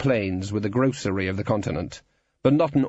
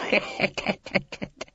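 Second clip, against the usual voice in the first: high breathy staccato cackle; wheezing, rattling titter; villainous snicker; 11 semitones higher, 3 dB quieter than usual. Acted. Guild boss gloating over penny whistle lad, villainous snicker.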